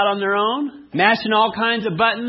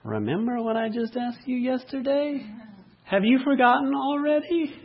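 higher, mock wifely reminder; imitated wife; 5 semitones higher, 3 dB quieter than usual. Wife reminds husband of yesterday's request, higher-pitched imitation. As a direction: play a wife checking on a forgetful husband. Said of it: "Sometimes my wife says that".

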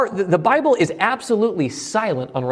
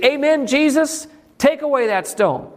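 imagined eager Martha; 11 semitones higher than usual. Retold or imitated. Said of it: "you would assume that Martha's gonna say".

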